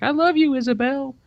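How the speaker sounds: high sweet toy voice; cute doll voice. Retold or imitated. Father imitates daughter's teddy bear, high and sweet. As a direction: squeak it out like a talking teddy. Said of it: "it'll be like".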